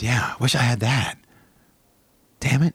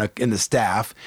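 normal talking voice

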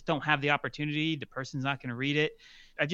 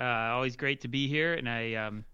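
ordinary talk, nothing marked

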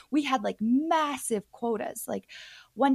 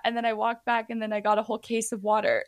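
ordinary delivery

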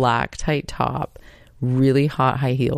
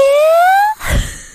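high squealing laugh, much louder; shrieking laugh; 9 dB louder than usual. Retold or imitated. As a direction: let the shriek break into a squeal. Mom's shriek continues.

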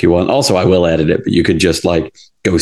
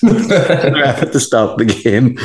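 laughing; 7 semitones higher, slower than usual. Real, not acted. Laughing while telling.

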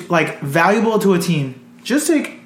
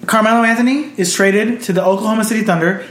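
ordinary talk, nothing marked

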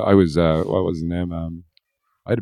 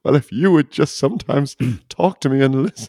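mock whiny wife; 8 semitones higher than usual. Wife's voice in mock quarrel.

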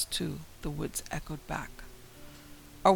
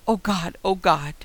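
loud terrified shriek, louder on the repeat; screaming; 2 semitones higher, 9 dB louder than usual. A narrator's reading. Alice screaming as something hits the car roof, terrified. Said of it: "Alice screamed".

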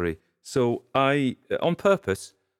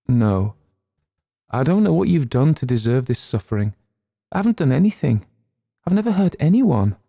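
tearful pleading; 6 semitones higher, 7 dB louder than usual. A narrator's reading. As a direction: voice rising high and louder through tears. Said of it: "crying freely now".

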